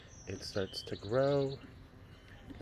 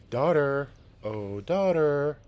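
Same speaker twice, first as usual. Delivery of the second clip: louder, higher drawn-out call; 5 semitones higher, 9 dB louder, slower than usual. Acted. Wife calling their daughter to help, drawn-out call. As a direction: call her from across the yard, slowly.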